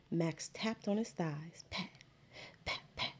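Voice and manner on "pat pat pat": breathy whispered pat, pat. Acted. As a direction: whisper the taps softly. Soft tapping on thighs.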